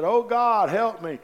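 high, loud panicked cry from a man hanging off a cliff; desperate cry for help. Acted. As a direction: scream it out, clinging to the tree. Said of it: "he cried out and said".

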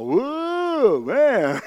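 excited whoa. Retold or imitated. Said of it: "Boy, I let it all go".

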